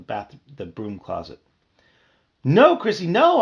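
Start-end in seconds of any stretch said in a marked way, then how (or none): 2.5-3.4 high, loud desperate scream. Acted. Bee screaming at his sister with the swatter. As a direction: scream to stop her. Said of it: "I screamed".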